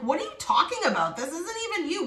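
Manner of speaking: high, disbelieving challenge; mock the friends who can't believe it; incredulous people. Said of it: "now people are like".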